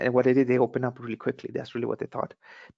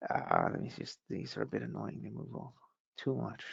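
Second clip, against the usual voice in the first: softly, half under the breath, to himself; quiet self-talk while adjusting the chart; quiet aside; 3 semitones lower, 10 dB quieter than usual. Real, not acted. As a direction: drop the voice while fixing something on screen. Fiddling with screen, talking to self.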